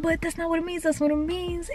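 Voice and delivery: high, soft mock protest; mimicked arguing. Friend arguing over what it means, high soft mimicry. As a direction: imitate her insisting, light and high.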